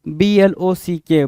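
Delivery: slow letter-by-letter spelling; dictating letters; spelled out. Shown as a demonstration. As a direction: spell each letter slowly. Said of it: "ब्लॉक".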